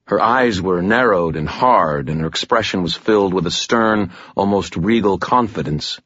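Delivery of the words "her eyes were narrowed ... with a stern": ordinary delivery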